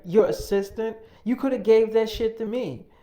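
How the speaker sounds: retold jealous girlfriend shouting at him; indignant outburst. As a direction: demand the job back, offended. Girlfriend jealous about assistant job.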